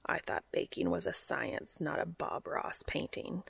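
low, soft grumbling under the breath; quiet frustrated mutter at the stove. A narrator's reading. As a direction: mutter it half to yourself, barely voiced. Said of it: "he had heard muttering".

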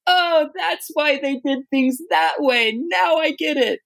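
high, drawn-out "aha" voice; slow, high-pitched eureka reenactment; dawning realization. Retold or imitated. Missionary retelling how he and his wife got it, dawning realization. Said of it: "And we're like".

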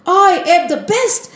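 high-pitched, elated self-congratulation; overjoyed boasting. A narrator's reading. Narad Muni marvels at his own power, overjoyed. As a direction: crow with delight at your own strength. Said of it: "He was so happy".

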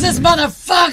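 loud, high mock yell; retold outraged shout. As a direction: yell it like the fed-up bartender. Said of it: "He goes".

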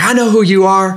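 high, loud, thrilled declaration; excited. Retold or imitated. Veteran recognizing the runner, high excited exclamation. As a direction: burst out with recognition.

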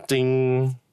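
bright high ding sound effect. Pretend. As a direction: chime it out high.

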